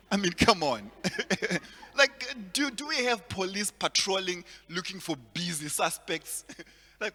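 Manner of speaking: usual speaking voice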